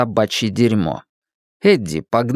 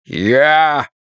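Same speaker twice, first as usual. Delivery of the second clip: booming voice; 2 semitones higher than usual. Acted. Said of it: "прогремел голос".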